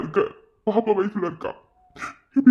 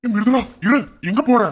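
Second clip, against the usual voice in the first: loud, raised call to stop someone; urgent, higher-pitched shout; 4 semitones higher, 7 dB louder than usual. Acted. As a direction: call after them in alarm. Calls after patient getting away.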